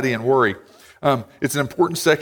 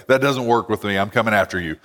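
low mock bear voice, lightly done; a hint of a grumbling bear, joking; 6 semitones lower than usual. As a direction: voice the bear lower, playfully menacing. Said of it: "he'd probably have a voice like".

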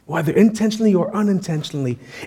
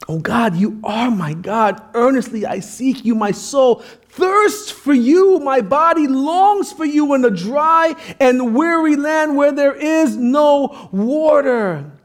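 higher, drawn-out, swelling declamation; impassioned scripture reading; 6 semitones higher, slower than usual. Acted. Preacher reciting David's psalm to his church, fervent and soaring. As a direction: cry out the psalm with rising intensity.

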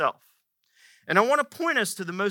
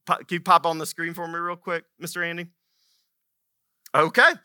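normal talking voice

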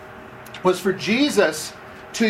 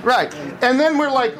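normal talking voice

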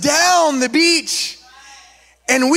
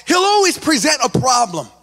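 preaching; 5 semitones higher than usual. Acted. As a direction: drive the lesson home to the congregation. Preaching the message.

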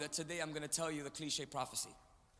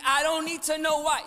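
pitched up and much louder for the crowd to repeat; louder lead line; 11 semitones higher, 11 dB louder than usual. Shown as a demonstration. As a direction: push it out big so the church shouts back. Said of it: "Say with me".

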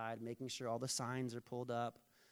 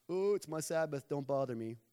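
mock excuse; 6 dB louder than usual. Mocking a lazy excuse.

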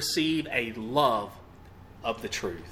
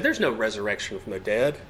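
mocking scoffers; 6 semitones higher than usual. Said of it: "Some just laughed at him and said".

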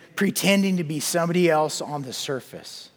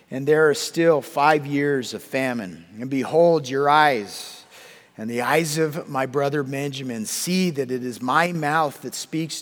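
louder, steady Bible reading; scripture reading; 5 dB louder than usual. Acted. Preacher reading Genesis aloud to the congregation, declaiming. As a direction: proclaim the passage to the church.

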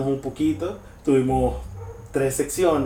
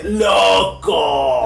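a sudden hammed-up cry of amazement; excited shout; 5 semitones higher, 6 dB louder than usual. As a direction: shout it like the show blew your mind. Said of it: "Nadie te dice".

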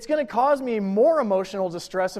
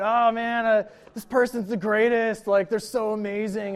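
higher, swooning over a new crush; infatuated gushing; 4 semitones higher, 3 dB louder than usual. Pretend. Pastor mimics a smitten single person, gushing. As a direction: gush like you're smitten. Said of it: "dating is kind of emotional".

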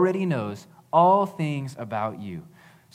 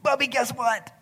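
put-on much higher, eager toddler voice; excited little girl; 11 semitones higher than usual. Dad imitating his daughter telling her brother about dessert, excited high child voice. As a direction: squeal it out like a thrilled three-year-old. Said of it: "And she's like".

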